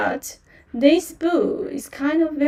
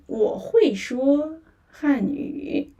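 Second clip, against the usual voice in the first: normal talking voice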